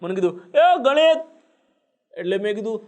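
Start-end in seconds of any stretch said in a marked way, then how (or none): 0.6-1.2 high, loud retold shout of "Ganesh"; a cop calling a man back across the room. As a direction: yell his name to stop him leaving. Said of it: "તમે બૂમ પાડી".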